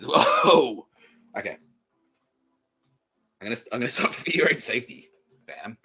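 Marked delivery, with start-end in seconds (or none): 0.0-0.9 high, slow, loud cry of alarm; drawn-out whoa. Real, not acted. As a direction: rein them in with a long startled whoa. Stopping the crowd, crying out.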